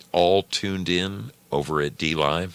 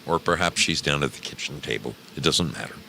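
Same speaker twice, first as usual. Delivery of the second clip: ordinary talk, nothing marked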